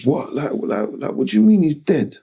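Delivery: retold stunned what do you mean; shocked disbelief. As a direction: relive the moment the news hit. Retelling hearing of friend's death.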